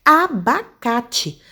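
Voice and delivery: abacate stretched out slowly for counting; teacher modelling a word for a syllable count; slow model word. Shown as a demonstration. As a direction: say abacate slowly so each part can be heard. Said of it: "Se eu disser, por exemplo".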